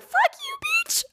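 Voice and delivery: extremely high, squeaked comeback; squeaky retort. Retold or imitated. Retelling her comeback to a teasing friend, squeaky retort. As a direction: squeak the insult with a failing voice.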